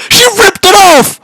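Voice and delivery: real disbelieving shrieks with laughter; shocked. Real, not acted. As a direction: lose it completely at the twist. Shocked reaction to the game.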